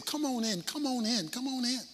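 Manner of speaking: hushed, coaxing plea to come inside; soft pleading. Acted. Father coaxing his angry son inside, soft pleading. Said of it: "began pleading with him".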